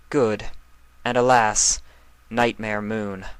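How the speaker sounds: loud, regal, with a sigh of alas; Luna, resigned. Acted. Luna calling her own dark alter ego. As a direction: call the last name reluctantly. Luna calls the last name.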